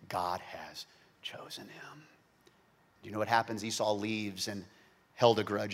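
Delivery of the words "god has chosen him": slow, whispered; hushed whisper. Acted. Isaac's awed verdict whispered to the congregation. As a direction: let it fall to a breath.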